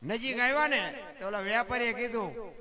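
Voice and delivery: usual speaking voice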